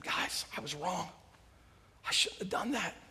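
very soft whispered admission of wrong. Acted. David confessing to his men in the cave, whispering. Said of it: "he whispers back to them".

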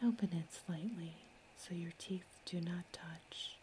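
usual speaking voice

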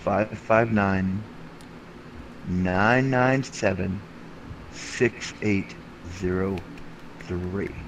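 slow, each digit set apart; staccato. Shown as a demonstration. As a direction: spell out the number digit by digit. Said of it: "I'll say it one more time".

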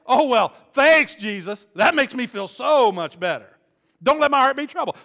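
a congregant's hammed-up sarcastic reply; sarcastic thanks. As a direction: throw the thanks back, dripping sarcasm.

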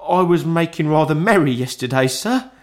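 a frightened clerk begging pardon; timid clerk. A narrator's reading. Bob keeps pleading.

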